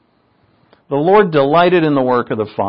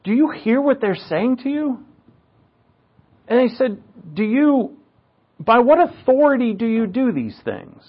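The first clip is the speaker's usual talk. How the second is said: lightly voiced challengers, higher pitch; challenging chief priests; 7 semitones higher than usual. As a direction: raise the pitch to question his authority. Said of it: "they say to Christ".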